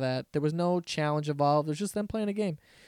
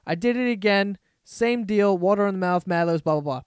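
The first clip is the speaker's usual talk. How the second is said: ordinary talk, nothing marked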